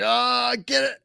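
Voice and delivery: high-pitched acted-out reach for it; mock straining cry. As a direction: imitate a player lunging for the ball. Acting out a near interception.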